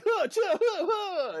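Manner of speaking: high, loud martial-arts grunts; mock ninja "huh" and "cha" strikes; imitated fight noises. Host imitates ninja dad escaping bad guys, loud fighting yelps. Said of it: "when he's escaping from the bad guys".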